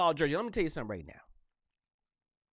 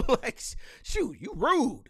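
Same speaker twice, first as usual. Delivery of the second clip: laughing indignant; 6 semitones higher, slower than usual. Real, not acted. Laughing mock offended.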